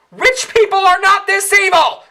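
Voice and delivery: high, loud outraged yell; exasperated shout. Acted. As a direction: shout at the screen. Ranting at the film's villains.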